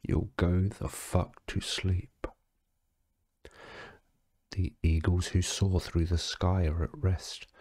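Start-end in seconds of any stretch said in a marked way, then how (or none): none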